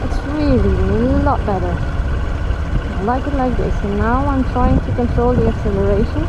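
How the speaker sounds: muffled voice in a helmet; talking inside a helmet on the bike; inside a motorcycle helmet. Real, not acted. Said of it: "You can hear me in, in the helmet".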